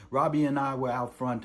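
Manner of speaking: ordinary talk, nothing marked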